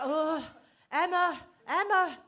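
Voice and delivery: hammed-up slow moaning of Emma; mock moaning name. Imitating her date moaning the wrong name in bed. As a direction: moan the name, loud and drawn out. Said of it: "when he's going like".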